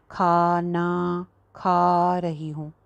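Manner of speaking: every aa in खाना खा रही हूँ stretched far too long; overlong vowels. Shown as a demonstration. Teacher mocking an overlong alif in everyday Urdu. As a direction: drag out each long vowel, very slow. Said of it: "हम ऐसे तो नहीं कहते".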